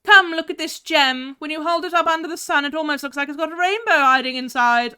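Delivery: high, loud put-on recital; weird reading voice. Streamer reading a game quote to viewers, weird high put-on voice. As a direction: read it in a silly weird accent. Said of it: "should I read this in a weird accent".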